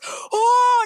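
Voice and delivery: high, loud mock-frightened "oh"; imitated startled cry. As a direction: yelp like a gunman caught off guard. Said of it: "and he goes".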